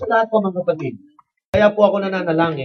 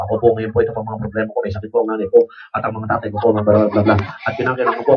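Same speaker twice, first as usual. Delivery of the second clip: mock news anchor reading a prayer, low; deadpan news read turned into a prayer; newscaster prayer; 8 semitones lower, much faster than usual. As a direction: pray like a newscaster reading headlines. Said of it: "Newscasting yun".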